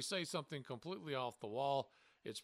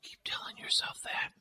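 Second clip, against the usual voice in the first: sarcastic whisper; whispered sarcasm. Acted. Host whispers a teasing comeback to his cohost. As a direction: hiss it under your breath, teasing.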